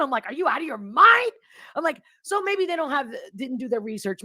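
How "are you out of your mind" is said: higher-pitched, acted disbelief; incredulous retort. Answering friends who push the jab, incredulous. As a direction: throw it back, appalled at the very idea.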